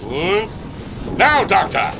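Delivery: put-on German-accented captain shouting the mark; shouted signal. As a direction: yell the cue to fire. Captain's signal to flip switch.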